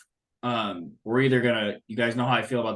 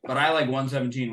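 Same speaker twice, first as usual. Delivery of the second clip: usual speaking voice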